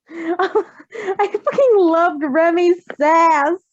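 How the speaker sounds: slow, laughing gush; delighted laughing fan reaction. Real, not acted. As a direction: savor it through a laugh. Gushing about a character.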